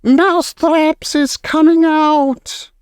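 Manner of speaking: high, slow triumphant witch shout; gloating witch proclamation. Acted. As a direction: proclaim it to the hall. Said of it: "raised her voice louder than ever and shouted".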